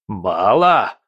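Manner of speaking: indignant bellow at a single word; roaring outrage. Acted. Prince roars at the general's warning, outraged. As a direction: hurl the word back in disbelief. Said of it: "Бобшильд взревел".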